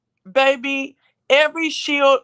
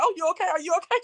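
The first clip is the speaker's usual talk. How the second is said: concerned Dan; 6 semitones higher, 3 dB quieter, much faster than usual. Retold or imitated. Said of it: "Dan's arm go like".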